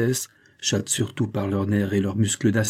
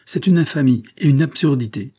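high, loud indignation; heated, emphatic denunciation; furious outburst; 5 semitones higher, 6 dB louder than usual. A narrator's reading. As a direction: protest in outrage, voice raised. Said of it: "sa fureur, véritable rage d'enfant gâté".

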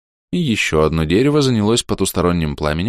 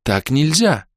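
возмущённо; 7 semitones higher, slower than usual. A narrator's reading. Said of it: "вознегодовала Кира".

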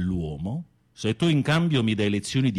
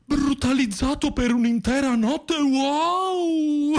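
camp, over-the-top delight; mock thrilled gasp; slower than usual. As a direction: gasp then high, theatrical wow. Mock thrilled at his story.

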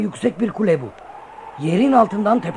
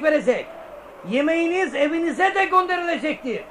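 town crier; 8 semitones higher than usual. Acted. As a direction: call it out to the whole square. Herald announces to the townsfolk.

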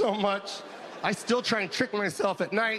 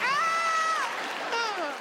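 doctor's mock yelp. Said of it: "She was like".

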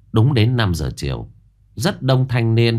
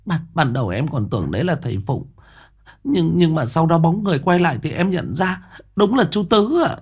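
higher, hurried, anxious sister-in-law; frightened young woman; 9 semitones higher than usual. Acted. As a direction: tell it fast, still shaken. Frightened sister-in-law recounting ghost.

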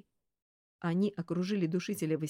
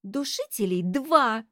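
slow, raised, pointed correction; reasoned objection; 4 semitones higher, 6 dB louder, slower than usual. A narrator's reading. Girl arguing with the detective over her fee, reasoned objection. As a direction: state the count deliberately and louder. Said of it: "резонно возразила Василиса".

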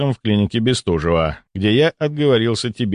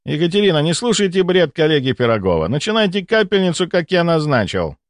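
lightly voiced bossy doctor, higher pitched; self-assured doctor; 8 semitones higher than usual. Doctor orders nurse to ignore his colleague, self-assured. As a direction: give orders with raised pitch.

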